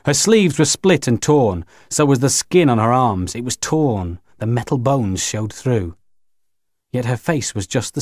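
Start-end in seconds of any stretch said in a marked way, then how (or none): none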